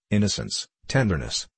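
plain reading voice

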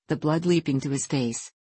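usual speaking voice

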